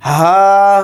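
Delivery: ہا breathed from the chest; heavy chest h. Shown as a demonstration. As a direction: push ہا up from the chest. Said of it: "ہا کو بھاری آواز میں پڑھیں گے، سینے سے". (ہا سینے سے بھاری آواز میں)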